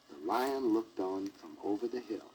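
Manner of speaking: ordinary delivery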